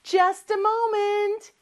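high, drawn-out call back; too busy to help. Acted. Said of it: "너무너무 바쁜가 봐요".

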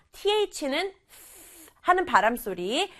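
usual speaking voice

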